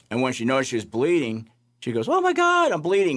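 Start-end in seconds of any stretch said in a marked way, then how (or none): 2.1-3.2 high, louder panicked cry; imitating a frightened patient's shriek; hysterical panic. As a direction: gasp it out in alarm at the blood. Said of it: "she's getting hysterical".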